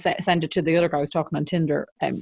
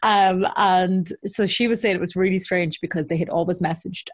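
ordinary talk, nothing marked

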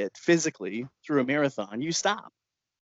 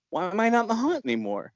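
high, quick indignant question; acting out an affronted customer, pitched up; indignant demand; 10 semitones higher, faster than usual. Woman out of shock questions her rescuer, indignant.

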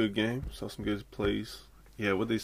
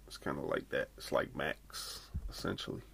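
whispering; 7 semitones lower, 10 dB quieter than usual. Real, not acted. Dropped to a whisper.

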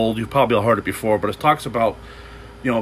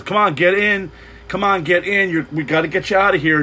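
higher, urgent rescuer call from a boat; 6 semitones higher than usual. Retold or imitated. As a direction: call them urgently to get in. Patrol calling couple from boat.

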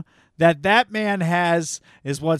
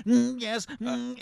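odd little hummed tics around a quiet word; imitated vocal tics; 4 semitones higher, 7 dB quieter, slower than usual. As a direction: mimic a movie character's verbal tics.

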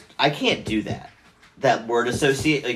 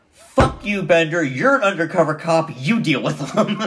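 put-on louder, higher, belligerent drunk buddy; drunken defiance; 6 semitones higher, 3 dB louder than usual. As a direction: lash out like a hammered friend, imitated.

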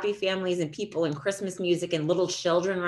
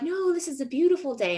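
lightly voiced old man; 9 semitones higher than usual.

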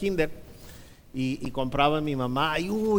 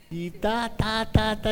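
higher, rhythmic "ta" hits; imitated slipper smacks; 6 semitones higher, slower than usual. Mom hitting him with the flying slipper, imitated smacks. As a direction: hammer out each mock smack.

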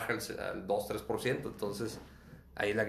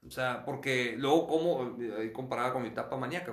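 ordinary delivery

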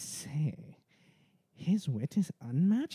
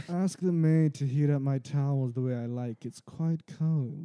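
slow, loud pampered royal complaint; foppish spoiled boy; 3 semitones lower, 6 dB louder, slower than usual. Acted. Spoiled royal son whining to daddy, slow foppish voice. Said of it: "It's a very foppish".

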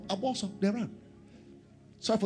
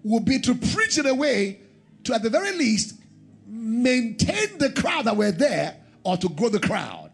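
high, loud, ringing pulpit voice; full-throated sermon climb; preaching at full force; 6 semitones higher, 5 dB louder than usual. Acted. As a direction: drive it home to the congregation. Preaching to the crowd.

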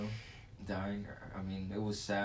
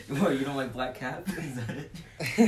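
teasing through laughter; laughing slowly while teasing; 4 semitones higher, much slower than usual. Real, not acted.

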